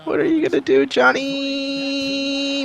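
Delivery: high, soft teasing voice for the player; mock player taunt. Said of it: "John Paxson says".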